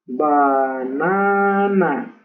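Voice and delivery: "Banana" sounded out very slowly with Spanish vowels; Spanish sounds, drawn out. Shown as a demonstration. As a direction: stretch "Banana" syllable by syllable, every a a Spanish ah. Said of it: "vamos a hacer los sonidos en español".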